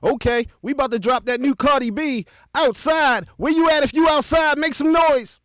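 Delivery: high, loud MC shout; big high-pitched party-hype shout; hyped DJ voice. Shown as a demonstration. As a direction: rev up the crowd for the drop. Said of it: "check out the other way".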